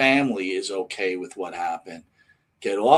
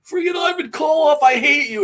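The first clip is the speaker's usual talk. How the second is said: high, loud heckler's yell; mock screaming; 8 dB louder than usual. Imitating some guy who spots the wrestler, mock screaming. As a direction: scream it like an outraged wrestling fan. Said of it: "And starts screaming at him".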